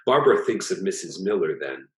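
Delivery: ordinary delivery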